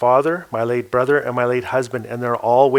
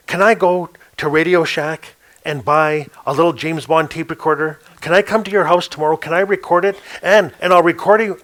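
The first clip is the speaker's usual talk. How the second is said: higher, fast, tumbling eagerness; eager pitch; 3 semitones higher than usual. Retold or imitated. Young storyteller eagerly begging the elder to let him record her. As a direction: rush the plan out like an excited kid.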